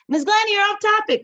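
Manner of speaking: high, loud student voice; high-pitched, loud mock student call-out. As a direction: pipe up like a pupil catching the teacher. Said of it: "you tell me".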